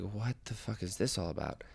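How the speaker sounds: hushed wary thought to yourself; muttered aside. Retold or imitated. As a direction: mutter it privately, well under your voice. Inner reaction to approaching stranger.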